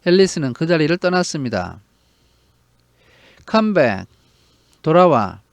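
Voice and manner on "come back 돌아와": mild narrated call to come back; lightly voiced calling after her; calling after someone. A narrator's reading. Caterpillar calls after Alice as she leaves, mild call. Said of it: "The caterpillar called after her".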